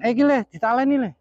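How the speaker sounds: high startled outburst; sudden high yelp of surprise; surprised exclamation. Real, not acted. Surprised by kite pull.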